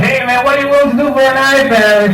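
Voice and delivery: high-pitched put-on voice; pushy fan following him. Comic mimicking someone following him to his car, high pestering voice. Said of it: "anybody following me to my car".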